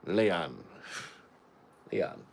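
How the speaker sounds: put-on Jamaican accent, Leon with an "ee" sound; Jamaican lion. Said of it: "Sounds like a Jamaican saying lion".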